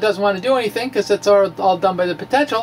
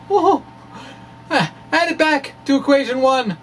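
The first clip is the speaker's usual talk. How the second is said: mock alarm; 7 semitones higher than usual. Mock distress over lost H bar.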